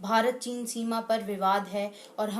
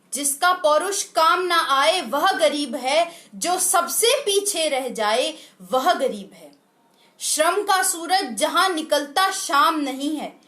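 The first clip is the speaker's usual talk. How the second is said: higher, louder declamation, building to the end; impassioned poem recitation; 4 semitones higher, 5 dB louder than usual. Acted. Presenter rouses countrymen against enemies within, impassioned poem recitation. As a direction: declaim the verse as a stirring rallying cry.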